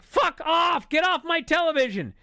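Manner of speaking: high, loud retold outburst; fed-up shout. Viewer yelling at the wrestler on television, fed-up high shout. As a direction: yell it at the screen, exasperated.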